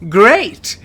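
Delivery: loud, high burst of enthusiasm; delighted exclamation. Acted. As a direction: exclaim it like a thrilled recruiter. Recruiter cheerfully hires the applicant.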